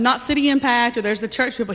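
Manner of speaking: usual speaking voice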